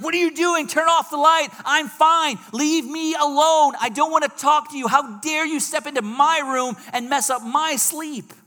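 high, loud indignant yelling; angry outburst. Acted. Woken sleeper yelling at intruder over the light, angry outburst. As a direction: yell in outrage, far above your normal pitch. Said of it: "you're gonna respond in anger".

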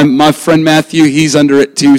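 ordinary talk, nothing marked

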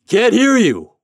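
high, very loud bellow; captain's straining long-distance holler; roared. A narrator's reading. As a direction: roar it across the river. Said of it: "roared the captain".

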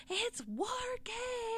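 high, drawn-out imitation of excited yelling; long, high-pitched pretend shout; mock fan yell. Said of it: "all three of 'em were yelling".

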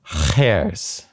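خرس with a drawn-out throat-scraping kh; throaty kh. Shown as a demonstration. Learner repeating the word with a throaty rasp. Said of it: "like bear hairs in your throat".

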